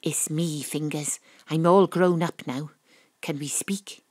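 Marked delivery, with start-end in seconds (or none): 3.2-4.0 quiet request. Acted. Detective asks quietly to talk.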